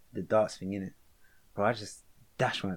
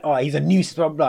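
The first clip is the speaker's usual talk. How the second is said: mocking teacher voice; 5 semitones higher, 8 dB louder than usual. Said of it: "they'll be like".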